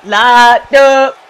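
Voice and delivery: real loud hype. Real, not acted. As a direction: call it out hyped. Hyped at defense.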